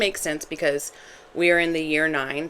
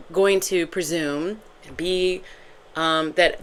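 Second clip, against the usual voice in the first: ordinary talk, nothing marked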